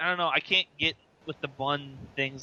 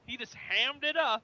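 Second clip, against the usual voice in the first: much higher, drawn-out catchphrase delivery; hammed-up quote; 10 semitones higher, 3 dB quieter, much slower than usual. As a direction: stretch it out high, mock overacting. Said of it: "whenever we talk about somebody overacting".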